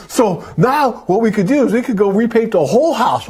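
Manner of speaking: excited wife, imitated. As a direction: act out your wife thrilled by a refund scheme. Wife's excited discovery, retold.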